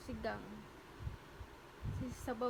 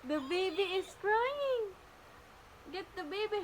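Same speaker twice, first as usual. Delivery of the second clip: high, loud, slow pretend panic; hammed-up fright to get the child to help the doll; mock alarm; 7 semitones higher, 8 dB louder, slower than usual. (kunwaring gulat na malakas)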